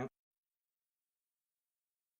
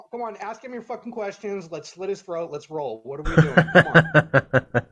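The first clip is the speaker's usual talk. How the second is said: impatient; 4 semitones higher, 12 dB louder than usual. Acted. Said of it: "He's impatient".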